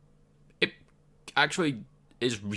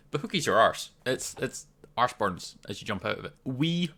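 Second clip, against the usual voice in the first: usual speaking voice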